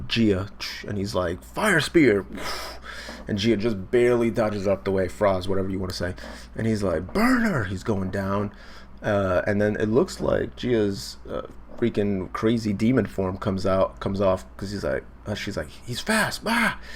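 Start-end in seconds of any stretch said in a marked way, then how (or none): 1.4-2.3 high, loud anime move shout; hammed-up battle cry; acted attack call. 7.1-7.6 high-pitched anime battle call; hammed-up shout, pitched way up; acted attack cry. 15.7-16.8 high, mock-panicked cry; acted alarm. Acting Gia's alarm.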